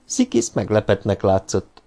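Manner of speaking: ordinary delivery, nothing marked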